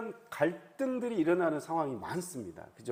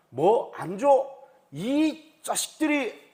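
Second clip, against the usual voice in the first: loud, drawn-out mock gangster threat; 깡패의 협박; 5 semitones higher, 7 dB louder, much slower than usual. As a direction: act out a thug losing his temper, loudly. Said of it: "이게 깡패의 논리 아닙니까". (깡패처럼 길게 끌며 버럭)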